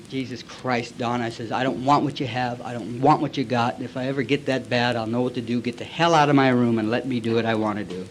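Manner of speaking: low, soft earnest refusal; sincere pleading. Retold or imitated. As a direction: say it quietly, in all drunken sincerity. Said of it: "with all the sincerity".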